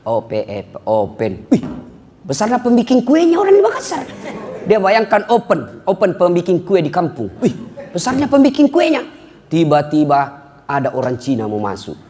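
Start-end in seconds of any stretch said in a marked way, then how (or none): 0.1-1.5 low, quiet beginner reading; spelling out. Acted. Rich villager spelling a sign at the mall, low imitation. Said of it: "Dia ejalah itu yang ada di depannya". 1.5-4.1 high, wowed villager; mock amazement. Villager mistaking a lift for an oven, mock amazement. 7.4-9.1 high, wowed villager; mock amazement. Villager mistaking a lift for an oven, mock amazement.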